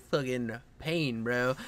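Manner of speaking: low, soft, drawn-out reaction; subdued mock pain. New dad reacting to a tearjerker, quietly pained. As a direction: say it slowly under your breath. Acting out his movie-watching reaction.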